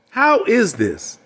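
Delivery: drawn out, pressing the question; slow emphatic. Shown as a demonstration. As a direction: lean on each word slowly.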